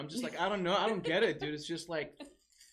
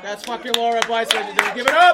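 loud, high announcer shout; crowd hype; 5 semitones higher, 19 dB louder than usual. Real, not acted. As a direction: whip up applause with a big shouted send-off. Host announces act to crowd.

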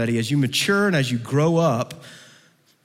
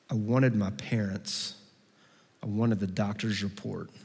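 soft, low, halting lament; hushed, low-voiced hurt; quiet disappointment; 6 semitones lower, 6 dB quieter than usual. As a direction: list the losses softly, pausing between each. Voicing disappointed prayers to God.

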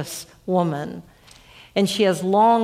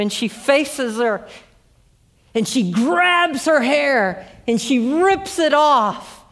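preaching climax; 5 semitones higher than usual. Acted. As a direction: drive the story home from the pulpit. Builds to the sermon climax.

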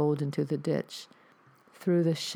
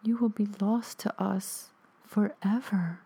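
calm ghostly mother; 5 semitones higher than usual. Acted. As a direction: lay down the verdict calmly. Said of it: "my mother calmly said".

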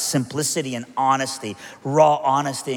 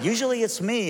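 Jesus answering; 8 semitones higher than usual. Pretend. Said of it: "and Jesus is like".

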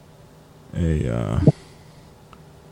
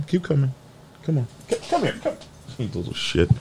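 muttered at the dog; 5 semitones higher, 11 dB quieter, faster than usual. Real, not acted.

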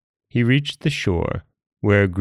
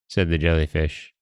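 ordinary delivery, nothing marked

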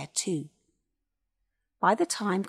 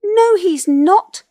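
high, loud, drawn-out shout; shrill little squeal of defiance; shrill tiny voice; 12 semitones higher, 16 dB louder, slower than usual. A narrator's reading. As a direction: shout back as a tiny creature. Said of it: "shouted a shrill, tiny voice".

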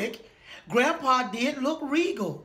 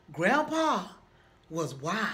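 usual speaking voice

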